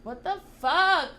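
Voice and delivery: high startled outburst; shocked. Real, not acted. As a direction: blurt it out in disbelief. Shocked by creepy dictionary voice.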